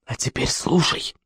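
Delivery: soft, slow, secretive; near-whispered command; conspiratorial hush. A narrator's reading. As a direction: draw him in under your breath. Conspirator about to tell secret.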